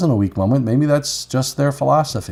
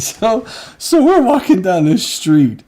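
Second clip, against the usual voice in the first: higher, louder laughing through the words; can barely get it out for laughing; laughing while telling; 12 semitones higher, 4 dB louder than usual. Real, not acted. Telling a friend the Marrakesh story, laughing hard.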